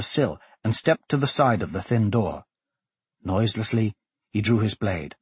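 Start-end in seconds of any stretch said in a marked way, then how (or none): none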